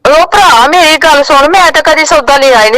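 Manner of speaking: angry protest. Real, not acted. Angry woman disputes prank debt call.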